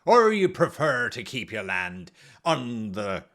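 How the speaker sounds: put-on low, slow old sea captain; pirate voice. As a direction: growl it like a salty pirate captain. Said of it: "I just like doing the pirate voice".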